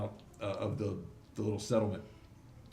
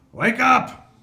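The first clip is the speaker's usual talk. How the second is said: high, very loud yell; shouted wake-up call; 13 semitones higher, 15 dB louder than usual. Acted. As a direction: bellow to rouse a sleeper in a tent. Boss yelling into a tent.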